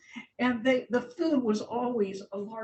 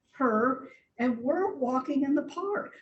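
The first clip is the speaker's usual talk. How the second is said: usual speaking voice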